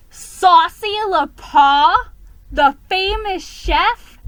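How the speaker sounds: slow, high disbelief; astonished. Acted. Narrator stunned by the famous chef's past, gasping. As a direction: stretch it out in amazement.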